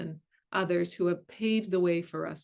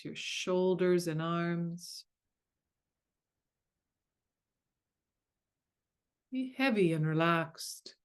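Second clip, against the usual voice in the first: guided meditation. Acted. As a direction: ease them gently into stillness.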